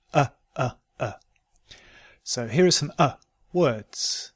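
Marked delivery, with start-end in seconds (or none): 0.1-1.1 UH as a short, relaxed neutral vowel; short unstressed "uh" sound; short schwa. Shown as a demonstration. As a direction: say "uh" short, each one separate. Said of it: "it's a short vowel".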